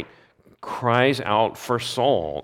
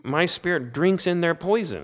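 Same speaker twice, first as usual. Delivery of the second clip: much higher, pained plea as Job; raised, aching voice of suffering Job; anguished Job; 9 semitones higher than usual. Retold or imitated. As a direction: cry it out like a man in torment, pitched way up. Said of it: "And he's saying".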